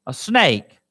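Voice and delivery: normal talking voice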